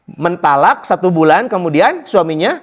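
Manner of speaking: normal talking voice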